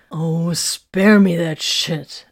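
loud, slow exasperated groan of words; fed-up, drawn-out demand; impatient. A narrator's reading. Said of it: "Arik demanded impatiently".

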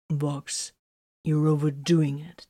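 hushed warning whisper. A narrator's reading. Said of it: "Titus warned".

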